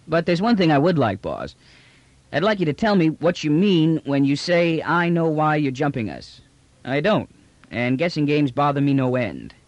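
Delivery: slangy trader losing patience with riddles; brash trader, insistent. Acted. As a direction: press the boss for a straight answer. Trader demands an explanation.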